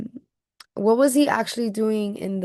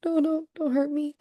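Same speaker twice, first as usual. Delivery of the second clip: higher, pleading little cry of a frightened man; teacher voicing the scared man; mock scared plea; 7 semitones higher than usual. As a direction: beg the jaguar not to hurt you, hammed-up. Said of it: "He's like".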